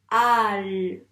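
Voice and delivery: al lancé fort; sound isolated. Shown as a demonstration. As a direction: marquer al. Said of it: "on entend le son". (son al prononcé fort)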